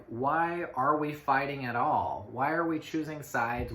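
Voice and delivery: ordinary talk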